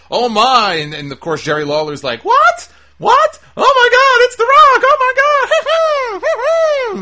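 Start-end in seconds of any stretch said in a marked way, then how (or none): 0.1-0.7 high, loud mock gasp of surprise; host imitating the announcer's surprised cry, loud and high; Michael Cole's yelp. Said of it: "So Michael Cole is like". 2.3-7.0 very high, loud, squealing fanboy shriek; mocking the commentator's reaction to The Rock in a piercing falsetto; Jerry Lawler squeal. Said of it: "if he reacts in that high-pitched voice".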